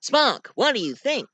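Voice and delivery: high, lilting captain impression; hammed-up heroic captain; starship captain. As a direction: coax the crew with swooping emphasis. Captain urges his doctor and officer.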